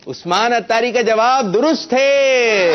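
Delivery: high, slow, stretched-out proclamation; triumphant announcement. Real, not acted. Quiz host declaring the child's answer right, triumphant announcement. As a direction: call it out high, drawing out the ending.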